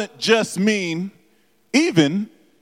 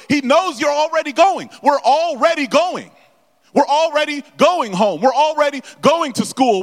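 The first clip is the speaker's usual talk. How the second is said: rising preacher refrain; 6 semitones higher, faster than usual. Acted. Preacher builds a repeated refrain.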